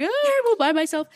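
high, loud mock-pitiful sigh of a line; mock lonely whine. After her mum went home, high mock-lonely whine. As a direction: play up being left all alone. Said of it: "and I was like".

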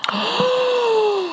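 long, high-pitched gasp; shocked gasp. Real, not acted. Reacts to the branded pet's arrival.

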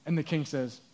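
ordinary delivery

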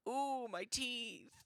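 slow mock pained moan. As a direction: moan about sore teeth. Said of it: "it hurts to talk".

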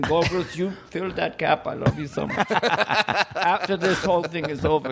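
lightly voiced gushing dictator; a touch of mock-fey affection; charming Hitler. Hitler thanking Goebbels after awkward silence, lightly gushing. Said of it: "it's just charming Hitler".

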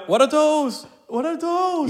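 mock meme shout. Mocking ugly feet with the meme, high and loud. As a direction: jeer like the viral meme.

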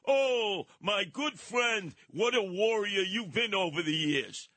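lightly mocking the mayor's effusive greeting; imitating Eric Adams. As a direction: lay the flattery on thick like the mayor. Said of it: "And Eric Adams goes".

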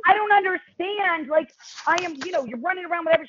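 high, baffled disbelief; bewildered protest. Retold or imitated. Telling the wardrobe designer in mock bewilderment, pitched high. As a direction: act baffled at the news.

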